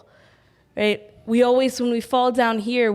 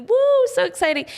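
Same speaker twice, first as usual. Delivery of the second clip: excited whoop; 11 semitones higher, 3 dB louder than usual. Real, not acted. Joy at new life.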